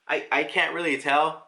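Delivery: ordinary talk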